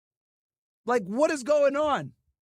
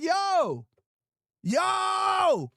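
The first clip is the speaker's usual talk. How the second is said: usual speaking voice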